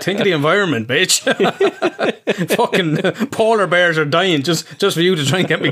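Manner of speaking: high, laughing mock scold at the wife. A husband mock-scolds his wife about the heating, laughing. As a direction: scold her, half laughing at yourself. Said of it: "I'm like".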